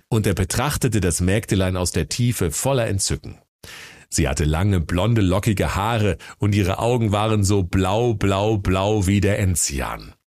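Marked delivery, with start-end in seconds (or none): none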